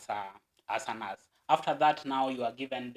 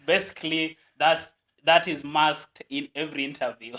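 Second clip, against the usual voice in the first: laughing; 4 semitones higher, 11 dB louder than usual. Real, not acted. Guest laughs at interview question.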